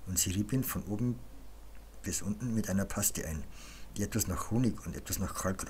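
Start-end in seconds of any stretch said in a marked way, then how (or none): none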